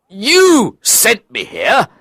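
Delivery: high, drawn-out indignant outburst; indignant shout. Acted. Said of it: "I shouted".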